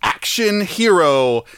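slow, high-pitched showbiz introduction; grand announcer. Pretend. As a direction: announce the star with drawn-out fanfare. Grand mock introduction of film star.

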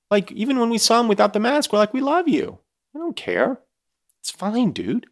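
squeaky, very high fan gushing; high, doting coo; high-pitched gushing. Acted. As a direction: gush in a falsetto, reassuring him. Gushing fans addressing the masked man.

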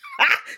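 squealing burst of laughter; high shriek of laughter. Real, not acted.

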